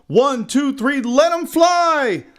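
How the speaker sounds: high, loud holler, last word stretched; big rising shout; hollered countdown. A narrator's reading. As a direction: holler it out to launch a cast. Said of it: "the men hollered".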